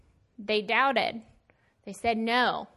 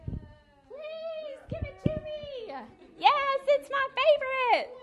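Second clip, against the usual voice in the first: put-on very high, slow pleading child; slower than usual. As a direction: beg like a little kid. Voicing a child begging for gifts.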